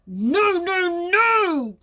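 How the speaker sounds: loud, rising grumpy refusal; drawn-out wolfish protest; grumbling. Acted. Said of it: "grumbled the wolf".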